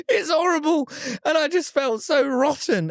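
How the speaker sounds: high-pitched comic dismay; distressed, squeaky storytelling; appalled wail. Real, not acted. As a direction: squeal it in horrified disbelief. Appalled at the dog incident.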